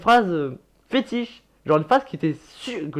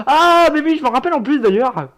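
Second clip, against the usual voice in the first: loud, high-pitched burst of recognition; high opening cry, easing slightly; sudden recollection; 10 semitones higher, 6 dB louder than usual. Real, not acted. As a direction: exclaim as it suddenly comes back. Sudden recollection while watching old video.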